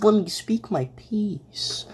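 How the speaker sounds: unvoiced, high, slow whisper. Real, not acted. Streamer pleads to finish own thought, whispering. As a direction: hiss the plea under the breath. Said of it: "I'm being angry right now".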